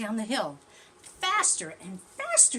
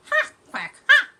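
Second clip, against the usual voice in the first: louder, higher quacking taunt; gloating duck; 14 semitones higher, 15 dB louder than usual. Acted. Duck gloating at the sliding tortoise, loud. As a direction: crow it like a smug duck. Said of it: "cried the duck".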